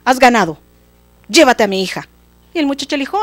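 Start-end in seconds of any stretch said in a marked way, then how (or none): 0.0-2.1 loud, exasperated surrender; raised voice, fed up and giving in; angry king. Acted. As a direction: cut the boy off in royal anger. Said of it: "el rey se enojó tanto".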